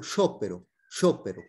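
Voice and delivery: Italian "sh" opening sciopero. Shown as a demonstration. Teacher gives student an example of sc-i, sciopero with "sh". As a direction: model sciopero with sc said as "sh". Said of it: "ese es un sonido que suena sh".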